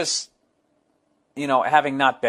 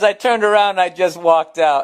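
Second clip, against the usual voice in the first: reading it out while laughing; 5 semitones higher, 7 dB louder than usual. Retold or imitated. Reading the fan's letter, laughing loudly. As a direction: laugh the line out loud. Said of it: "And he goes".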